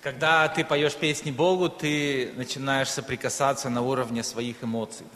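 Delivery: ordinary delivery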